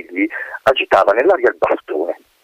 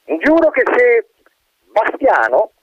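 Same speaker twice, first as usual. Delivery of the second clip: roaring colonel; 13 semitones higher, slower than usual. Acted. Colonel roars his name, correcting the wind. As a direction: roar the name to correct him. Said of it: "ruggì il colonnello".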